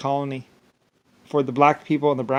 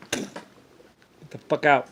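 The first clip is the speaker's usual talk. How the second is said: ordinary talk, nothing marked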